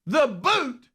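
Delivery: high, loud incredulous yell; imitated scream. Head coach reacting to the called play, screamed in disbelief. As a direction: shriek it in a very high voice. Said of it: "he said he just screams out".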